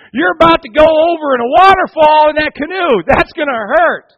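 high, loud, calling down from the mountain; loud know-it-all shout. Retold or imitated. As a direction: shout the warning down, slowing at the end. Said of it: "They go".

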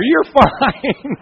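real laughter breaking in; amused chuckle mid-line; laughing. Real, not acted. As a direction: laugh through the word fine. Laughing while retelling.

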